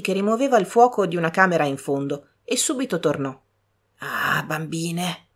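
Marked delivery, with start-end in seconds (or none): 4.0-5.3 soft, very slow, grieving sigh; sorrowful sigh. A narrator's reading. Old servant mourning the master to the girls, soft and slow. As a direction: let it out sadly, almost breaking.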